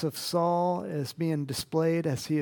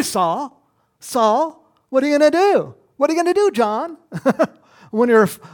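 louder, higher, pressing the question; preaching at full force; 5 semitones higher, 9 dB louder, faster than usual. Acted. Preacher challenging the congregation, full-force preaching. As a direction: hammer the question home to the crowd.

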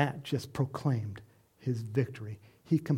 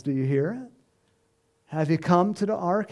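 normal talking voice

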